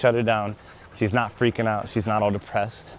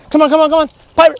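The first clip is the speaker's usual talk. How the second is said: excited dog praise; 10 dB louder, much faster than usual. Real, not acted.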